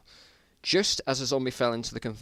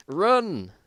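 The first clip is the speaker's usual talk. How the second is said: high, loud cry to run; shouted warning; 9 semitones higher, 7 dB louder than usual. Acted. Trapped in a zombie nest, shouting to his friend to run. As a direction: yell it as the roars come.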